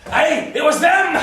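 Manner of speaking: high, loud alarm; hammed-up dread at full volume; panicked shout. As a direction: cry out the dreadful news. Alarmed claim about kidnappers.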